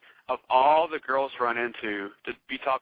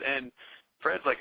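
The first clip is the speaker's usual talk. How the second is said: ordinary talk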